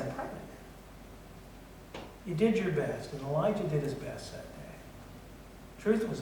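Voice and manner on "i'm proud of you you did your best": hushed, very soft, slow "I'm proud of you"; tender praise. Retold or imitated. Coach to his team after a close loss, hushed and tender. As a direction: breathe it to them like a hug.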